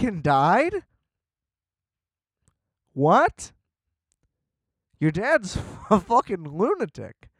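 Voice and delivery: high, slow, disbelieving gasps; mock horror. Retelling the girlfriend's story, shocked and incredulous. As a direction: recoil at a grotesque discovery. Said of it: "And then it's like".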